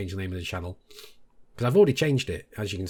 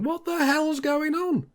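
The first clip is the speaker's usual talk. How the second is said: much higher, slow, baffled mock cry; flabbergasted; slower than usual. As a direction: stretch it out in disbelief. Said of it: "I'm kind of flabbergasted here".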